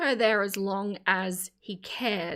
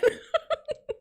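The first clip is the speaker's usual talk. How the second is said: high giggle. Real, not acted. Laughs after mouth full.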